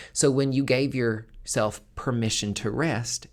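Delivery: ordinary talk, nothing marked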